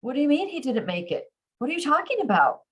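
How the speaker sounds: high, incredulous questioning; baffled, pitched well up; confused disbelief. A narrator's reading. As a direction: demand an explanation in bewildered shock. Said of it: "Confusion paints his face".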